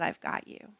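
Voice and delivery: a hushed, intimate whisper; still small voice. Acted. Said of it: "that still small voice".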